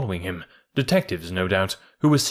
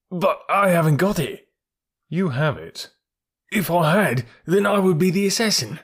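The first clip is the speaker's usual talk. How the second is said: desperate denial; 8 semitones higher than usual. A narrator's reading. Accused man protesting to the detective, high and desperate. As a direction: deny it with rising panic.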